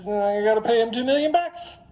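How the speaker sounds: loud, very high-pitched mock wail; acted outburst. Husband breaking news to wife, loud high-pitched outburst.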